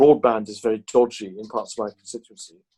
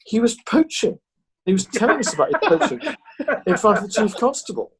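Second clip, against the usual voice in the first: high, amused telling, laughter breaking in; laughing disbelief; 11 semitones higher than usual. Real, not acted. MP telling the poaching anecdote to hosts, laughing. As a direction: marvel at the cheek of it, laughing. Teller amused by own punchline.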